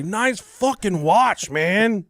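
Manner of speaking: high, drawn-out mock holler; calling out. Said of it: "I'll say".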